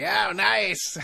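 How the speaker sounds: a light imitation of a sunny clerk; cheery cashier.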